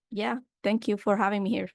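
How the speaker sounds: ordinary delivery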